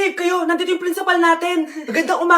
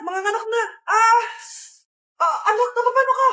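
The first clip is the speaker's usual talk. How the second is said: panicked; 5 semitones higher than usual. Acted. Labor starts.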